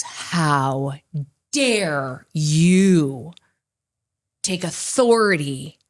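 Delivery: a hint of a thundering, angry God; booming mad God. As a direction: retell God's furious rebuke with some weight. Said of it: "he is booming mad".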